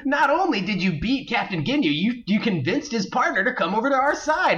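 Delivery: high, naive praise; cheerful Krillin. Retold or imitated. Cohost mocking Krillin praising fake Goku, high and naive. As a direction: gush it like a clueless friend. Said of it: "the best part is he's like".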